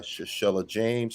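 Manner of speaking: usual speaking voice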